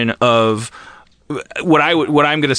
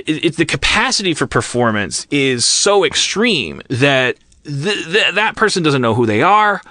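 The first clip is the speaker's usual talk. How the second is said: normal talking voice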